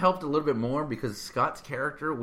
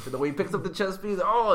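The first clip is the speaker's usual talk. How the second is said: ordinary talk, nothing marked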